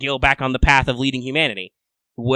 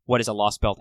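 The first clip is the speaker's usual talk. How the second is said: ordinary talk, nothing marked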